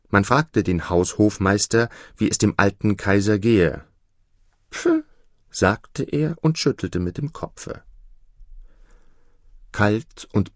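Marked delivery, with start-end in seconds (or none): 4.7-5.0 a sharp high scoffing puff of air; the chamberlain's curt high pf; dismissive puff. Acted. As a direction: brush the question off with a puff.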